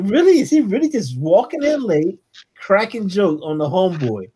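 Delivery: high, loud disbelief at the start, easing down; retold astonished protest; incredulous reaction. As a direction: question it like you can't believe the nerve. Retelling own disbelief while reading.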